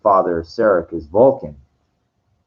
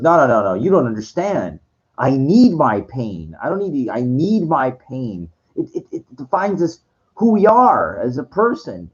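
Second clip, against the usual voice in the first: higher-pitched, emphatic retold protest; acted impassioned refusal; 6 semitones higher than usual. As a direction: plead your case insistently, as if imitating him. Retold Kirk refuses to lose pain.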